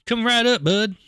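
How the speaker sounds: high, loud, chirpy reply; wrong character voice. Acted. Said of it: "That's the wrong voice".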